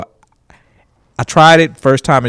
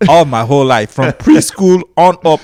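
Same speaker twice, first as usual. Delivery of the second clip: Nigerian accent. Shown as a demonstration. Comedian showing how he fools crowds, Nigerian accent. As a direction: slip into a put-on Nigerian English. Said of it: "I would talk like this".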